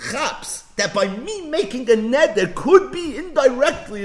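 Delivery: normal talking voice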